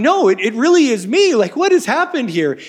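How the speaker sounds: panicking son who cannot believe it; distressed Esau. Acted. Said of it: "Esau's going".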